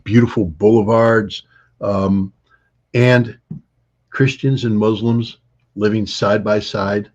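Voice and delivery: ordinary talk, nothing marked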